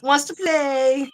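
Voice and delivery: high, loud, drawn-out playful dog voice; slow, excited pet-talk; Frank, all excited. Retold or imitated. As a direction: voice an eager pet begging for a game. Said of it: "he got all excited".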